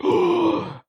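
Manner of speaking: high, sharp mock gasp of shock; imitated gasp. Recalling big bombastic game moments, mock shocked gasp. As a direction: suck in air as if floored. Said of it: "made me go like".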